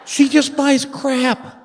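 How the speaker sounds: high, slow exasperation; exasperated complaint. Acted. Husband griping about wife's shopping, high and exasperated. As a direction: draw it out high in comic despair.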